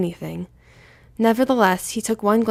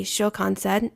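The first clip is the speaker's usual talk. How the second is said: usual speaking voice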